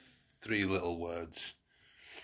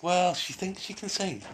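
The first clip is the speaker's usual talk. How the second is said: mocking; 11 semitones higher, 4 dB louder than usual. Mocking the singer.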